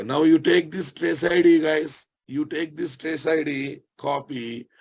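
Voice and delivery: normal talking voice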